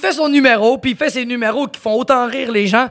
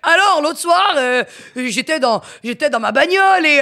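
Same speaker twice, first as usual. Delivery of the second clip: mock French accent; 6 semitones higher than usual. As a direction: do him talking like a Parisian. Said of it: "il parlait comme un Français". (imitation exagérée d'un Français)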